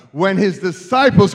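loud, insistent preacher's "When his disciples"; loud rallying repeat. Real, not acted. Repeats louder to rally unresponsive congregation.

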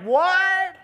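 high, startled what; baffled what. Retold or imitated. Nicodemus baffled by Jesus, a high startled what. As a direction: Nicodemus blurts out his confusion. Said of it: "Nicodemus is like".

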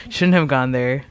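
ordinary talk

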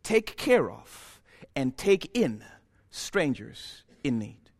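usual speaking voice

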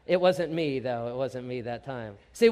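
ordinary talk

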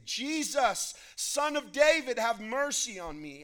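high, loud plea for mercy; crying out. A narrator's reading. Blind beggar calls to Jesus passing by, crying out. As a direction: call out loudly to be heard over a crowd. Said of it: "he began to cry out and say".

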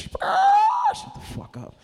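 very high, slow, loud shriek; drawn-out shout. Acted. Choking a chicken, telling it to hush, slow high shout. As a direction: stretch the shout out, high and silly. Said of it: "just shh".

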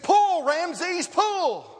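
very high, loud holler; calling out. Retold or imitated. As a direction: bellow the command as hard as you can. Farmer calling out to horse.

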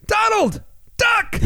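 high yell Donald, duck; Secret Service shout. Retold or imitated. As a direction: yell it like a panicked bodyguard. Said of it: "they say".

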